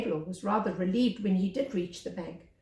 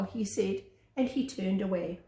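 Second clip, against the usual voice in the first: ordinary delivery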